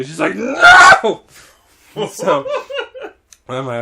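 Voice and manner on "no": very high, loud shriek; one explosive screeched refusal; freaking-out scream. Retold or imitated. Child refusing the teacher's help, freaking-out scream. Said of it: "she's freaking the fuck out".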